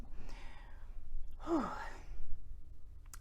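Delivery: soft, high, drawn-out sigh; emotional sigh. Real, not acted. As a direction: let out a long breath to steady yourself. Composing herself after tearing up.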